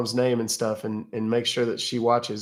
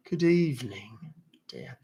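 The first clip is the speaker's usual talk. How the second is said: softer mock British "Good evening, dear"; soft mock British accent; 5 dB quieter than usual. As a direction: drop into a quiet, gentle British "dear". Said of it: "You love mimicking British accents".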